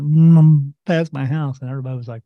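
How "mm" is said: loud, slow imitated car hum; mock engine drone; car going by. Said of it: "the car went".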